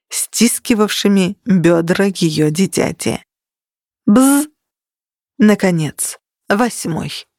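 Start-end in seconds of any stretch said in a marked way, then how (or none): 4.0-4.5 buzzing bell. A narrator's reading. Bell buzz.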